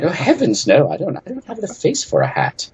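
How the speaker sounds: high, hammed-up posh British accent; fake posh British. Game designer demonstrating a dinosaur tea party guest, horribly posh fake British. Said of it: "speak with horribly posh, fake British accents".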